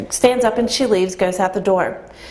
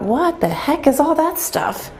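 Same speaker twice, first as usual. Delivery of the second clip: slow, much higher puzzled question; baffled; 8 semitones higher than usual. Retold or imitated. Visitor reading a strange shop sign, slow baffled wondering. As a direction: puzzle over it, drawn out and high.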